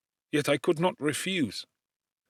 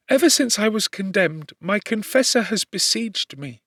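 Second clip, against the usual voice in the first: put-on higher, distraught girl's confession; a wretched young prisoner, pitched up; condemned servant girl; 8 semitones higher, 4 dB louder than usual. A narrator's reading. Justine speaks at length.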